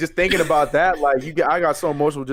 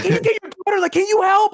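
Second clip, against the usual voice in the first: much higher mock pleading voice; imitating a plea. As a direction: whine for help like her.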